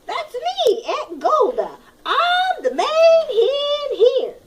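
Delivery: high cackling hen voice; squawky aunt hen. Acted. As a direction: crow it out like a bossy old hen. Aunt hen claims the role.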